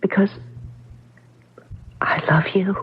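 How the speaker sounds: unvoiced, hushed confession; whispered love. She whispers her love back to him, hushed. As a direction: breathe it, barely voiced.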